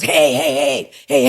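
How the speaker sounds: high, sharp calls of hey after her; boss calling out. Retold or imitated. Boss calling the worker back, sharp high calls. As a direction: call someone back who's sneaking off.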